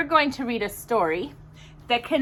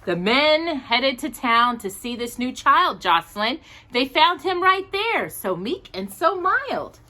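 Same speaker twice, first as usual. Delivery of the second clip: gentle rhyming storybook read; reading to children; 2 semitones higher than usual. Acted. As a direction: read the picture book aloud to little listeners. Reads a Christmas storybook aloud.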